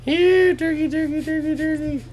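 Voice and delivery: high falsetto turkey call; imitating a singsong come-here call; animal call. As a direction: call them in like pets, very high. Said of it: "And you go".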